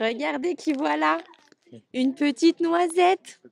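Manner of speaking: slow, sweet presenting voice; cute baby talk. Acted. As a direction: coo it like to a pet. (voix mignonne et attendrie)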